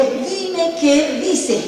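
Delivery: exigencia aguda y alargada; paroxismo actuado. Retold or imitated. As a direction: exige la traducción, arrastrando las palabras en agudo. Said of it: "Ahí llegué yo al paroxismo". (súplica exaltada, teatral, con voz muy aguda)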